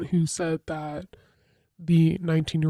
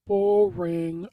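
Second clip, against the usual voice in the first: mock bored; 13 semitones higher than usual. Dismissing staying home for homework.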